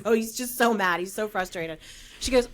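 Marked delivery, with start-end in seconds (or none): none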